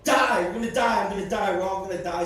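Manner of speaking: ominous shout; building warning. Acted. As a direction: hammer the ending. Building to die.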